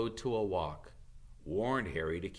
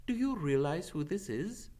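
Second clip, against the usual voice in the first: a higher, steady voice for the centaur; centaur Firenze; 8 semitones higher than usual. Acted. Firenze answering Bane's anger, higher and firm. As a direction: answer firmly, standing your ground.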